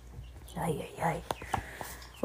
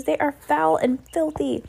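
high-pitched witches' chorus; high, emphatic crowd response; 9 semitones higher, 3 dB louder than usual. A narrator's reading. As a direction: echo it high, in full agreement. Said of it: "chorused the English witches".

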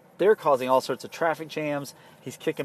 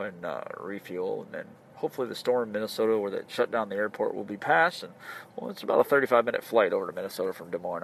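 lightly voiced captain over the intercom; a hint of a calm airline captain; pilot announcement; 4 semitones lower, 3 dB quieter than usual. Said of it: "then we hear this".

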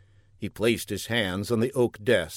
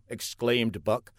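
plain reading voice

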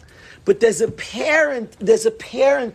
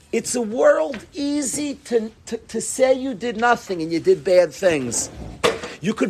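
usual speaking voice

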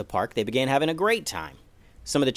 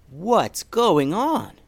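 slow, higher, louder bewildered mom; surprised question; 8 semitones higher, 5 dB louder, much slower than usual. Acted. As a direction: stretch it out in disbelief. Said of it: "Vanessa asked".

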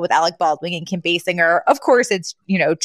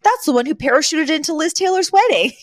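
high, thrilled star-spotter voice; excited Fran; 9 semitones higher than usual. Retold or imitated. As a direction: point someone out, pitched way up. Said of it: "at one point she's like".

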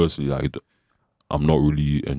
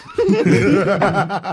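sudden high-pitched laugh; 4 dB louder than usual. Real, not acted. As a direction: burst into a laugh. Laughs at own joke.

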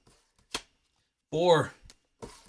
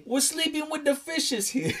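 high-pitched, hammed-up mobster accent; Italian-American mobster accent; 9 semitones higher than usual. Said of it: "That's why we're doing accents".

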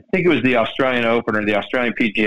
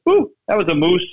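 Canadian guy; 6 semitones higher than usual. Retold or imitated. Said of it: "Canadian way of saying".

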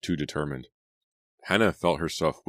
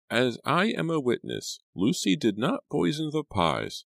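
higher housekeeper voice, firm and insistent; frowning housekeeper; 11 semitones higher than usual. A narrator's reading. Housekeeper insists to Hannah, firm higher voice. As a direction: repeat it like a sworn witness. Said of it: "Missus Mackenzie repeated, frowning".

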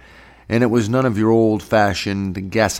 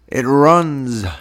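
amazed exclamation, higher and louder; raised, wondering cry; marveling; 5 semitones higher, 5 dB louder than usual. A narrator's reading. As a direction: marvel out loud at the sight. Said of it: "Tub marveled".

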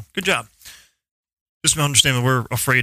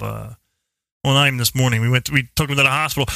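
ordinary talk, nothing marked

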